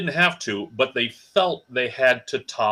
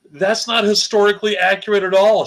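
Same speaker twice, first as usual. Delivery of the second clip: higher, louder exasperated comeback; exasperated retort; 8 semitones higher, 7 dB louder than usual. Retold or imitated. Retelling his retort to Jessica's stepmom, loud exasperation. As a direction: fling the retort back, mock outrage.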